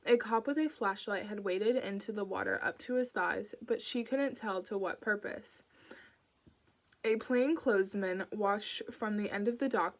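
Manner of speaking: ordinary delivery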